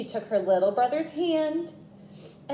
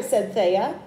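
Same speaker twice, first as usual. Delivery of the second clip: ordinary delivery, nothing marked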